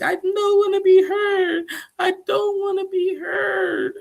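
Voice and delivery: very high, loud wailing sob; hammed-up crying shout. Shekinah wailing at Sarper in a hammed-up crying shout. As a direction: cry it out, slowing on the repeat.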